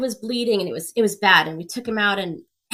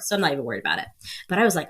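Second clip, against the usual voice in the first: ordinary talk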